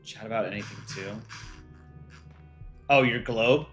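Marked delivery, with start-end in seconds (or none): none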